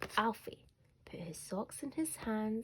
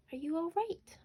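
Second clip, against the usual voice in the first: much higher, caring question; concerned mum; 10 semitones higher than usual. A narrator's reading. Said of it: "asked Mum".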